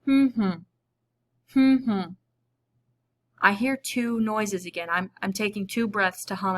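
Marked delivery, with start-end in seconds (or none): 0.1-2.0 two hummed beats, louder, closed lips; humming the syllables. Shown as a demonstration. Teacher demonstrating to the class, humming syllables. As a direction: hum out each syllable as a beat. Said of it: "try that same word with our humming".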